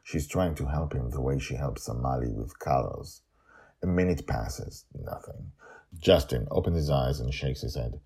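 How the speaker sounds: ordinary delivery, nothing marked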